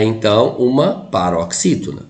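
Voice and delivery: ordinary talk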